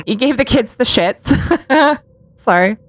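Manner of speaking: amused, breaking into laughter; laughing. Real, not acted. Host imagining the kids' mishap, laughing. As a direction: laugh through it, then apologize. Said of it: "I'm only laughing 'cause I'm literally imagining".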